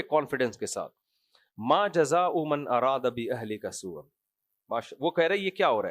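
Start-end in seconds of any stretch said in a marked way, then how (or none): 1.6-4.0 Quranic recitation. Acted. Preacher quotes Quranic verse.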